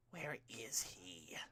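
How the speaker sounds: slow hushed whisper under the breath; muttered. A narrator's reading. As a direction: mutter it slowly, barely voiced, as if to himself. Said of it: "Tomura muttered".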